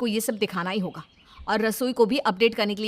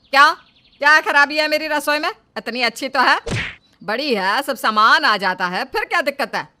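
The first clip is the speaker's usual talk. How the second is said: loud offended retort; mother-in-law loudly defending her kitchen; indignant defence; 3 semitones higher, 6 dB louder than usual. Acted.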